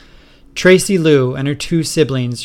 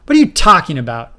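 baffled; 3 semitones higher, 7 dB louder, faster than usual. Acted. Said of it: "Fred asked, baffled".

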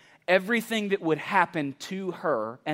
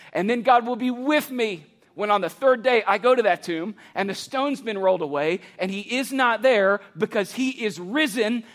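building preaching; 3 semitones higher than usual. Acted. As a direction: build each God will be with me until it peaks. Building litany of Mary's trust.

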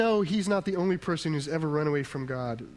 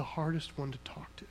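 whisper; 8 dB quieter than usual. Real, not acted. Sermon point, voice drops.

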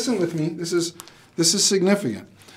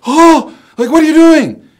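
mock-shocked coworker, much higher and louder; 13 dB louder than usual. Coworker gasps at the pastor's burger, high mock outrage. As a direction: squeal it like an appalled colleague.

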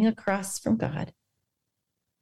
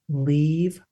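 lower, slow and firm; slow firm protection; 6 semitones lower, much slower than usual. Retold or imitated. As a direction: shield her gently but firmly. Jesus defending Mary.

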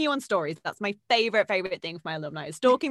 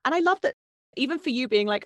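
ordinary talk, nothing marked

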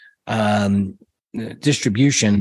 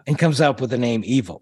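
ordinary delivery

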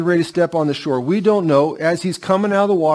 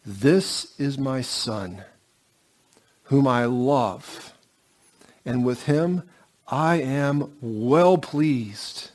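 slow, soft and solemn, each phrase set apart; voice of God the Father; 4 semitones lower, 6 dB quieter, much slower than usual. Acted. Preacher voicing God the Father to the congregation, slow and soft. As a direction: let each word fall slowly and gently, like a blessing. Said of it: "And the Father speaks".

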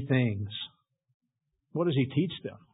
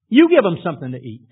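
louder and faster, YOU stressed hard; loud commanding repeat; 2 semitones higher, 10 dB louder, faster than usual. Acted. Preacher repeating Jesus's command to the disciples, loud and fast. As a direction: hammer home the order, fast and loud. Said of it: "he responded".

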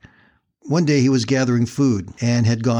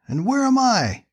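high, fast, desperate questions to God; desperate questioning; 11 semitones higher than usual. Acted. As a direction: plead like a man abandoned on an island. Stranded man questioning God.

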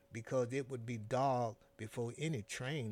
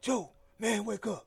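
urgent call; 6 semitones higher, 6 dB louder than usual. A narrator's reading. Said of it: "a gruff voice that whispered".

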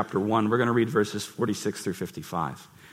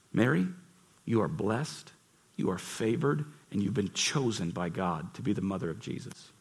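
tender blessing; 5 semitones lower, 9 dB quieter than usual. Acted. Gabriel's blessing quoted softly.

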